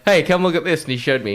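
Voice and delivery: ordinary delivery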